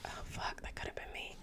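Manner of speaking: hushed, horrified whisper; whispered dread. Retold or imitated. Feyre reacting to the body in the rafters, horrified whisper. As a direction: whisper it under your breath in dread.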